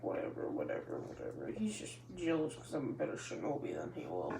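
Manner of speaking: sulky whispered grumble; boy sulking to himself, whispered; pouting whisper. Acted. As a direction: whisper it while sulking and kicking your feet. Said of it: "Naruto pouting and kicking the feet".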